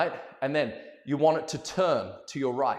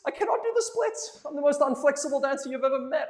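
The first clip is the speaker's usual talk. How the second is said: laughing protest; 8 semitones higher than usual. Retold or imitated.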